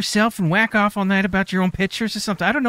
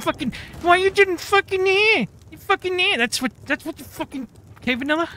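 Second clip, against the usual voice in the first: high mocking whine; 13 semitones higher than usual. Mocking a nagging mother-in-law.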